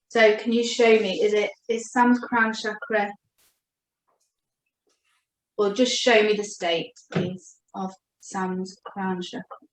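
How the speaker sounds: slow, hushed asking of a spirit guide; invocation. Acted. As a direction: murmur the request reverently, trailing off. Calls on the guides again.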